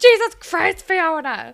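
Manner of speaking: mock exasperated yell; exasperated shout. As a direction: shout it in disbelief. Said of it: "And I'm just like".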